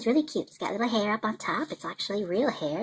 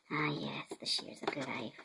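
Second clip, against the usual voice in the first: whisper; 5 semitones lower, 11 dB quieter, slower than usual. Acted. Savouring the scissors in character.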